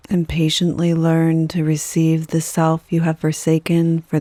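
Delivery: unhurried yoga nidra guidance, letting each phrase settle; guided meditation. Acted.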